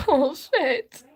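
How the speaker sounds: upset and fed up, voice jumping up; real outrage. Real, not acted. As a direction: burst out in bitter disbelief. Upset, denouncing the president's slogan.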